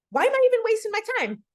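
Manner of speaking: high, fast frustrated outburst; acted exasperation. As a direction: blurt it out as if fed up. Acted frustration at being ignored.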